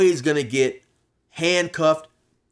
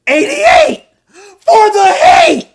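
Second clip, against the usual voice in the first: much higher, loud slow taunting yell; screaming; 16 dB louder, slower than usual. Retold or imitated. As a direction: scream it to provoke. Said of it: "he's saying eighty-eight".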